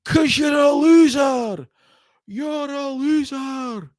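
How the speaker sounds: high, slow jeering shout of loser; mocking wrestler taunt over the speakers. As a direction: taunt him slowly from offstage, loud then dropping. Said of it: "over the speakers, you hear".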